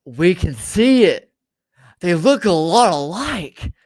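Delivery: loud, slow, drawn-out mock chorus; emphatic group chorus. Friends all commenting on the resemblance, emphatic. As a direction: belt it out slowly, stretching the words.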